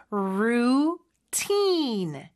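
"Routine" with both vowels drawn out long; slow demo of "Routine", holding each vowel. Shown as a demonstration. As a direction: stretch "Routine" into roooo-teeeen. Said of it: "모음 소리가 생각보다 길어요".

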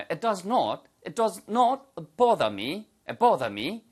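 bother said the British way with a rounded o, boh-da; slow British model, round the o in bother; British-accent demo with does not spelled out; British pronunciation. Shown as a demonstration. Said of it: "발음하시면 영국식 발음이 되겠죠".

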